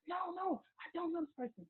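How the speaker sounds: high-pitched mock scream, held back in volume; retold screaming. Woman denying she knows the caller, retold screaming. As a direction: re-enact a panicked denial high, without shouting. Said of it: "So I started screaming".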